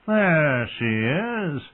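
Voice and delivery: high, very drawn-out smiling call; smiling menace. A narrator's reading. Drunken brother spots his sister, smiling as he calls. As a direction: stretch it out high, with a smile. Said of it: "he said, smiling".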